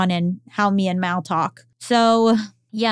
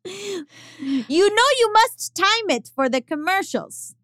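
slow, higher, Austrian-tinged and laughing; imitating her; 9 semitones higher, slower than usual. Quoting her script notes to the cohost, laughing Austrian-tinged imitation. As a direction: mimic the bossy old lady through laughter. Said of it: "read them to me".

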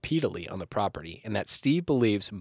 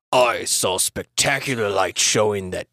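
put-on quote voice; 4 dB louder, slower than usual. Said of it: "Try that again".

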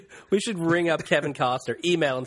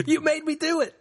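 high, exclaimed comeback; mock indignant retort; 13 semitones higher than usual. Boyfriend answering the dream accusation, mock indignant. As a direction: throw the blame back, much higher. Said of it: "I'm like".

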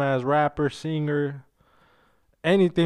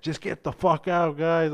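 angry teacher; 3 semitones higher than usual. Retold or imitated. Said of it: "that fool fucking just like".

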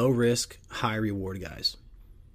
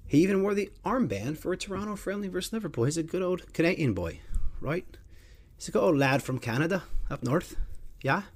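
high put-on Irish accent; 8 semitones higher than usual. Host joking about a Canadian player, in a put-on Irish accent. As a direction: lilt it like a cheerful Irish lad. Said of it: "It's an Irish accent, not Canadian".

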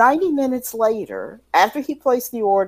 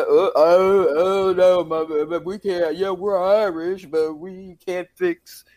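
put-on a light Rocky Balboa accent for a flustered cook; a hint of an Italian American accent, flustered; Rocky-ish Italian American. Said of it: "that sounded like Rocky Balboa for a second".

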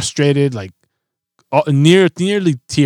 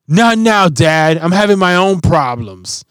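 higher, louder mock kid protest; imitated fed-up child; 5 semitones higher, 4 dB louder, slower than usual. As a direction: brush Dad off like a busy little kid. Voicing his fed-up four-year-old daughter.

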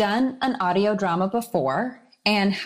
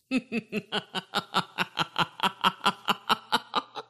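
a creepy villain's chuckle; evil chuckle; 5 dB quieter than usual. Acted. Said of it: "can you do Sandra's sinister laugh for me".

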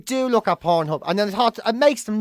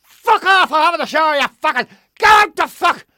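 furious roar, much higher and louder; 10 semitones higher, 8 dB louder than usual. Retold or imitated. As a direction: roar them off your doorstep. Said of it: "roaring out the little window".

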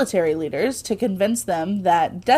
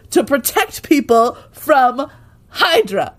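higher, emphatic disbelief; incredulous; 5 semitones higher, 3 dB louder than usual. Real, not acted. Host questioning the villain's absurd sales plan, incredulous. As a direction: spell out the absurdity, landing hard on key words.

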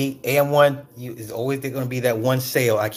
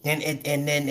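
usual speaking voice

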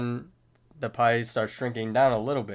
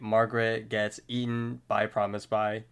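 ordinary delivery

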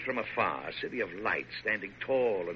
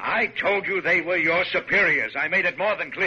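stern rebuke; 7 semitones higher, 6 dB louder than usual. Acted. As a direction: lay down the law to a stubborn man. President scolds the major.

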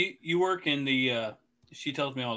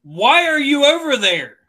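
higher, louder and slow; incredulous shout; 12 semitones higher, 10 dB louder, slower than usual. Retold or imitated. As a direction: blurt it out in disbelief. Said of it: "I'm like".

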